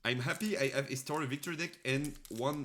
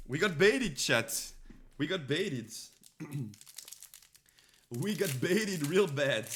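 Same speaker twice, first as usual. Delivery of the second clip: indignant; 3 semitones higher, 5 dB louder than usual. Real, not acted. Reacting to bait pull.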